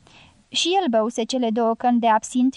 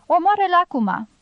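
higher-pitched, pressing prod; urging; 6 semitones higher than usual. A narrator's reading. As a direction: goad him on with mild insistence. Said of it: "îl îmboldi țiganul".

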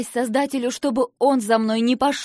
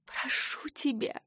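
whispered, slow, pleading and higher; breathy whispered plea; 5 semitones higher, 8 dB quieter, slower than usual. Acted. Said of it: "выдохнула я".

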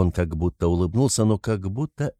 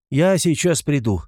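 raised, high-pitched call; 6 semitones higher, 4 dB louder than usual. A narrator's reading. Razumikhin to the mother as he runs out, calling. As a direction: call it out hurriedly. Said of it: "крикнул он".

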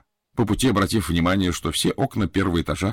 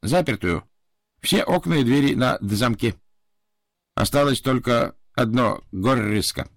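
put-on Japanese accent; 4 semitones higher than usual. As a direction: spell it out patiently like a Japanese servant. Japanese butler continues explaining. (японский акцент, неторопливо)

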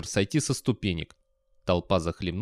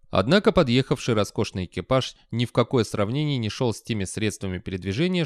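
ordinary delivery

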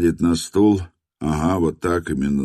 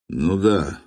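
plain reading voice

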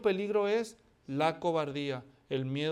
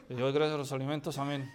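ventriloquist mumble; 3 semitones lower, faster than usual. Shown as a demonstration. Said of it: "de ventrílocuo acabo".

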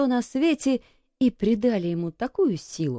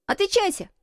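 put-on very high, commanding boy; imperious high-pitched order; 13 semitones higher than usual. A narrator's reading. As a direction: demand an answer in a high boy's voice. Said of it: "повелительно спросил Колин".

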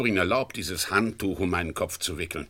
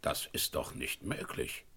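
whispered, slow and much softer; stunned whisper; 7 semitones lower, 9 dB quieter than usual. Acted. Inspector faced with a colleague unmasked, whispering in disbelief. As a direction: breathe it out in disbelief.

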